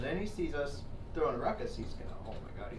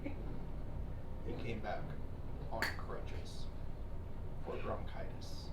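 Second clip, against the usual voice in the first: soft, breathy disbelief; hushed; 2 semitones lower, 4 dB quieter, much slower than usual. Real, not acted. Telling the host the absurd crutches punchline, hushed. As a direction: drop your voice for the punchline.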